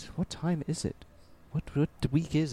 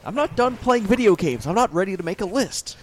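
loud whiny protest; 6 semitones higher, 8 dB louder than usual. Real, not acted. Protest about year-end lists.

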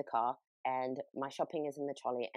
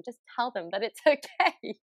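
laughing through tears; 6 semitones higher, 5 dB louder than usual. Real, not acted. Touched sincere plea to listeners.

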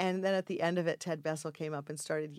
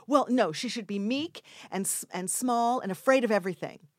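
raised, louder, emphatic note to an actor; director correcting; 5 semitones higher, 6 dB louder than usual. Retold or imitated. Director tells actress how the character should be, correcting. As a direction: correct the actor firmly, retold.

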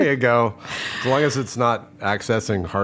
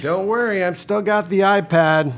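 high, slow pretend kid call; imitated child; 8 semitones higher, slower than usual. Pretend daughter reassuring hovering parents, high drawn-out call. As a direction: call up high, stretching the words.

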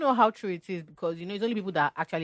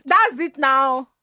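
slow, drawn-out Yoruba accent; 7 semitones higher, 9 dB louder, much slower than usual. Shown as a demonstration. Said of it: "This is the Yoruba".

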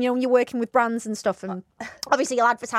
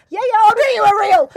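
eager blurt; 8 semitones higher, 8 dB louder than usual. Retold or imitated.

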